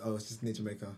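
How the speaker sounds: ordinary delivery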